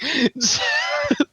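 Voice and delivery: shrill burst of laughter; squealing laugh; high laugh. Real, not acted. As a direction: cackle at the burn. Laughing after the punchline.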